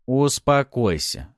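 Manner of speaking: strict and emphatic; stern. A narrator's reading. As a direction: order calm, drawing the word out. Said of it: "строго произнёс я".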